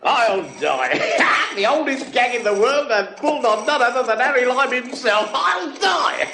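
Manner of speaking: roaring with laughter at Harry; helpless laughter. Acted. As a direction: crack up, barely able to speak.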